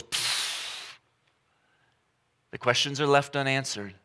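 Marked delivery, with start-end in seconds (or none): 0.0-1.0 soft unvoiced fizzle noise; breathy pfft of letdown; deflating pfft. Shown as a demonstration. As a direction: let it fizzle out like a dud. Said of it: "and then goes".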